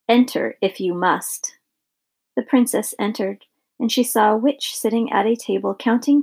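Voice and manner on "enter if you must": plain reading voice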